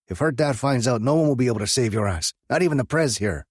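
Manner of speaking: high, loud, fast scolding; angry scolding. A narrator's reading. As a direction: tear into the cousin. Track scolding his cousin.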